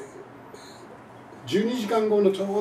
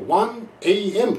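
very slow, each letter apart; slow and clear; 3 semitones higher, much slower than usual. Shown as a demonstration. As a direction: spell out one A M deliberately. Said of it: "あるいは英語ではですね". (一語ずつ区切ってゆっくり)

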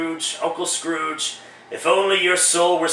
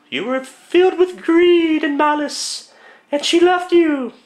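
much higher, airy woman's voice for the ghost; falsetto female ghost; 10 semitones higher, 3 dB louder than usual. Acted. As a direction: play the spirit as a tall lady. Ghost accuses Scrooge.

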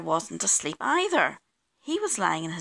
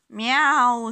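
higher, loud, drawn-out yowl; cat miaow; 4 semitones higher, 8 dB louder than usual. A narrator's reading. Cats singing on the garden wall, loud miaow.

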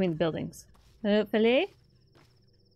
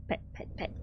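whispered petting; 16 dB quieter, faster than usual. Pretend. Pretending to pet the bull.

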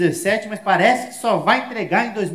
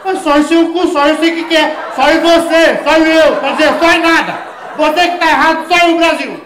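loud, higher shouting rant; much louder, higher angry shout; furious rant; 8 semitones higher, 8 dB louder than usual. Retold or imitated. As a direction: yell it all out, outraged and laughing. Ranting shout after apology.

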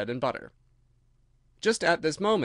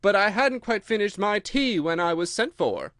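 lightly voiced apologetic Hatter, higher and louder; Hatter pleading to the king; 6 semitones higher, 5 dB louder than usual. Hatter explains his unfinished tea to the king, lightly pleading. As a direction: excuse yourself in a raised voice.

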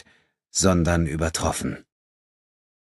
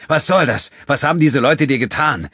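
much higher and louder, cutting command; sharp; 9 semitones higher, 6 dB louder than usual. A narrator's reading. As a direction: snap at him to stop now. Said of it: "sagte André scharf".

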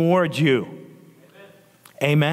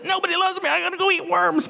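put-on self-pitying child; 14 semitones higher, faster than usual. Preacher as child complaining to mom, high self-pitying whine. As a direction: whine it high like a pouting kid. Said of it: "I said that so much".

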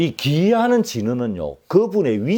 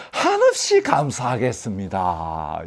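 slow, drawn-out grateful plea; ingratiating, lingering thanks; effusive thanks; 3 semitones lower, slower than usual. Acted. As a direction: stretch 한없이 out in flattering gratitude. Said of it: "이러면서".

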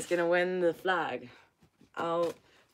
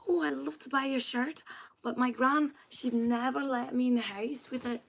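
imitating a sweet girl, voice pitched well up; 8 semitones higher than usual. Retelling an Irish fan wanting a shirt, high sweet girl voice. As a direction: put on a high, sweet girlish voice. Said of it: "this really sweet girl".

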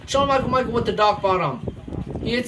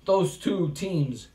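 loud, pumped-up wrestling commentary with yells at big moves; live play-by-play; 3 semitones higher, 3 dB louder than usual. Acted. Commentator calling a backyard wrestling match, hyped play-by-play. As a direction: call the match live for the crowd.

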